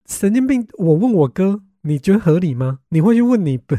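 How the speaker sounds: loud, high, disbelieving rant; incredulous outburst. Real, not acted. Telling viewers classmates wanted her to ask her monk brother, loud disbelief. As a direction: scoff at an absurd idea.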